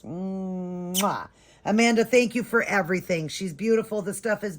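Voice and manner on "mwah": blown kiss. Real, not acted. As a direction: blow a drawn-out kiss to Amanda. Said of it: "let's give Amanda an extra kiss".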